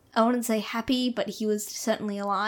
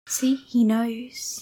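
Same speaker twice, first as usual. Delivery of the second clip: whisper; much slower than usual. Pretend. Echoing Ralph's doom, whispered.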